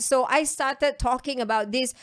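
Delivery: normal talking voice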